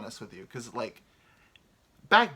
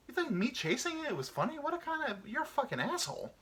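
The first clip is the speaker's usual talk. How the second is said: indignant reply; 14 semitones higher than usual. Acted.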